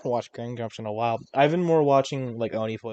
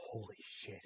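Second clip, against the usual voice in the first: stunned murmur; 5 semitones lower, 24 dB quieter, slower than usual. Pretend. Stunned listener reaction.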